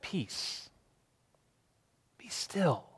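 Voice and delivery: whispered peace be still; hushed command. Retold or imitated. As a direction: breathe it out, calming the sea. Jesus calms the storm.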